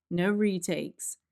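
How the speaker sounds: ordinary talk, nothing marked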